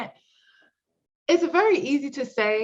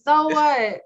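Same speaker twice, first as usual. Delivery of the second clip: sassy mock outrage; indignant; 5 semitones higher, 5 dB louder than usual. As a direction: toss off a dismissive so what.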